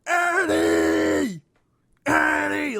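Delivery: high, loud shout of a name; yelling from the back. Retold or imitated. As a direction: call out across a crowd. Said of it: "one guy yelling in the back".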